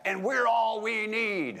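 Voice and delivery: high, loud, slow unison shout; shouted response. Retold or imitated. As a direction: roar it back as one. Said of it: "they shouted back".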